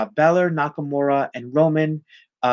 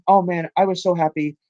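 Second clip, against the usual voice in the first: ordinary talk, nothing marked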